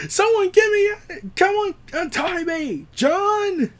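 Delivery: high, slow, whining plea; whiny complaining. Retold or imitated. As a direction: whine like a tied-up brute begging. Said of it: "the comedian goes ahead and bitches more".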